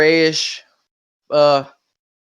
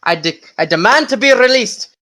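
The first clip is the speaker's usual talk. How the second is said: put-on indignant prisoner shouting in a wobbly Scottish accent; attempted Scottish accent; 6 semitones higher than usual. Angry inmate yelling at guards, failing Scottish accent. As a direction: demand release from the guards in a shaky Scottish brogue. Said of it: "does your guy have a German accent".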